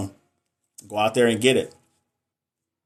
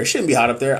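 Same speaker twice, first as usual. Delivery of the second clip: ordinary talk, nothing marked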